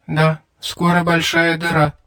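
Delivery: a small cold alien speaking through a translator; small creature voice. A narrator's reading. Alien creature answers via translator.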